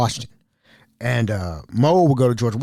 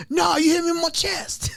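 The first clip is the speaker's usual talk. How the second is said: much higher, whining yelled complaint; whiny mock wrestler. Mel complaining after the super kick, whiny mock yell. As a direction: overplay the pain of the kick.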